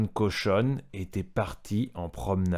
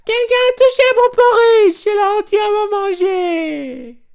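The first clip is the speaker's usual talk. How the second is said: very high, loud squeaky cub cry; little bear's tiny voice; 14 dB louder than usual. A narrator's reading. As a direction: squeal like a tiny cub whose bowl is empty. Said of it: "s'écria de sa petite voix". (petite voix d'oursonne, très aiguë et forte)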